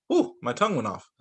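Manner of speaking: plain reading voice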